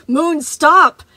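loud, high commanding cry; demanding shout. Acted. Freddy shouting at Moon to stop. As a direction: order him to stop, full force. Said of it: "Freddy demanded".

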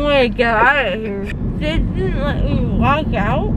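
mumbling around gauze pad; speaking through packed mouth; talking with gauze in mouth. Real, not acted.

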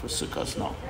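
forsook us dropped to a whisper; trying the word again under the breath; whispered aside. Real, not acted. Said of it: "whatever the word".

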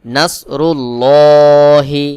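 heavy, rounded "lloo" in "নাসরুল্লাহি", held long and loud; slow chant with a dark full L in "নাসরুল্লাহি"; thick Allah sound. Shown as a demonstration. Teacher shows the rule, thick long "নাসরুল্লাহি". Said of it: "আল্লাহ শব্দকে পোর বা মোটা করে পড়তে হয়".

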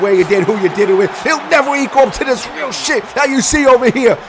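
high, emphatic promo rant; fired-up boast. Acted. Commentator boasting about his show, fired up. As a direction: proclaim it to the crowd with force.

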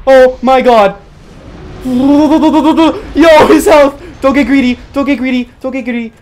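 high, loud excited yelling; frantic shouting. Real, not acted. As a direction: scream at the screen as the boss nearly dies. Boss nearly dead, frantic.

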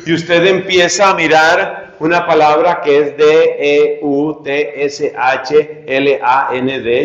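ordinary talk

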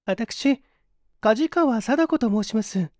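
put-on high, light voice of a young woman; young woman's voice. A narrator's reading. A young woman asks her companion if he's cold, high voice.

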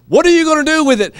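loud, high and fast challenge; shouted rhetorical challenge; loud question. Shown as a demonstration. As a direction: fire the question at them. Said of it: "quiet then loud repeat".

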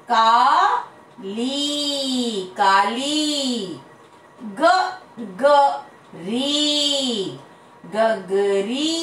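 पानी, काली, लीची stretched slowly on the final vowel; long ii drawn out. Shown as a demonstration. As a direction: drill each word slowly, holding the long vowel. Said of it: "मात्रा में बड़ा करना है". (लंबी मात्रा खींचकर, धीरे-धीरे)

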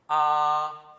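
"아" held long, high and level; first tone. Shown as a demonstration. Teacher leading learners to repeat, high level tone. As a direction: sing out "아" on one steady high note. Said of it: "가장 높은 음으로 발음하되".

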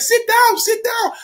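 high, insistent voice for the guy; lightly voiced eager host; imitating the restaurant guy. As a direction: pitch it up, waving him to sit.

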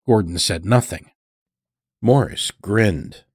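plain reading voice

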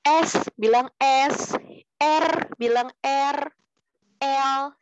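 slow, drawn-out letter names S, R, L; clearly articulated letters. Shown as a demonstration. Teacher showing pupils articulation, clearly articulated S, R, L. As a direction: sound out S, R and L slowly and fully. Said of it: "jadi jelas gitu".

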